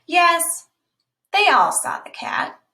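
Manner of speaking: ordinary delivery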